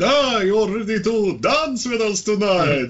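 high, slow, eerie vampire drawl; slow theatrical vampire host. Acted. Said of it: "fake sort of, like, vampire voice".

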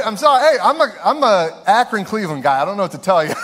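loud, high laughing aside; laughing apology. Real, not acted. Preacher laughs to the congregation about being from Akron. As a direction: laugh it off to the crowd.

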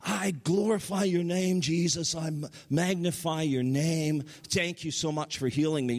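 high-pitched imitation of a woman praising; retold fervent praise. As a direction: cry out thanks as the healed woman, pitched high. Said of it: "and she's saying".

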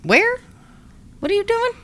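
high, rising incredulous questions; shocked disbelief. Retold or imitated. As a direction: recoil in disbelief. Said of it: "I would be like".

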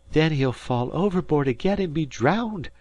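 high-pitched bitter mother. A narrator's reading. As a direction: complain bitterly as a worried mother. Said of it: "the mother said bitterly".

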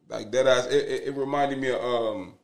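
ordinary delivery

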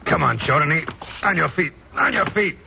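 rising, pushier commands; urgent order. Acted. Deputy hurries the drugged professor up, rising urgency. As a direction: haul him up, getting sharper. Hauling semi-conscious prisoner up.

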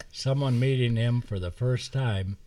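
ordinary delivery, nothing marked

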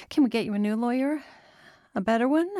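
high, quick hopeful questions; anxious daughter's quick plea; urgent suggestion. Acted. Daughter urges father to fight.

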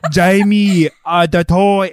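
loud mock Schwarzenegger Austrian accent; thick Arnold accent. Host mimics the dad talking to his son, Austrian accent. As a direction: put on a thick Austrian action-star accent. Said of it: "thick ass accent on".

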